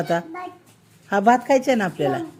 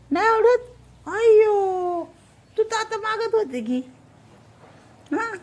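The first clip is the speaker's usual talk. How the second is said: put-on much higher, sing-song baby voice; baby talk; 12 semitones higher than usual. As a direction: coo at the toddler in a high voice.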